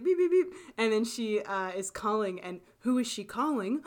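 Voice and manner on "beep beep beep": high, loud mock beeping; excited phone beep noises; imitated phone beeps. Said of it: "but she goes like".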